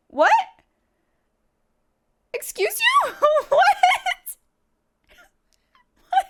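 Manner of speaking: high, loud squeals of What; repeated shrieking disbelief; stunned disbelief. Real, not acted. Streamer reacting to a celebrity follow, shrieking in disbelief.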